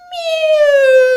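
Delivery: loud high-pitched meow; cat meow. Retold or imitated. The dead cat suddenly meowing, a loud high meow. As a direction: yowl like a startled cat. Imitating the cat coming alive.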